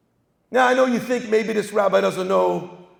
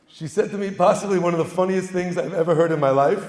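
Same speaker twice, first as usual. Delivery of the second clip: ordinary delivery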